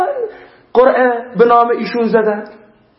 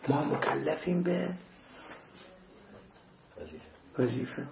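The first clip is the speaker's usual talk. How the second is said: وظیفه whispered, much softer and lower; hushed whisper; 4 semitones lower, 19 dB quieter than usual. Real, not acted. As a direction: let وظیفه fade into a soft whisper. Repeated refrain, whispered.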